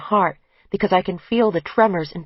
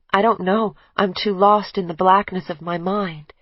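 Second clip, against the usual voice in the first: ordinary delivery, nothing marked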